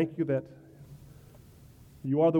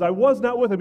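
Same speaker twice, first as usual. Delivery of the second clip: higher and louder, each word pressed; emphatic denial; 6 semitones higher, 7 dB louder than usual. Acted. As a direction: insist like a cornered man. Preacher voices Peter's oath.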